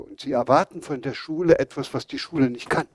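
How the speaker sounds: normal talking voice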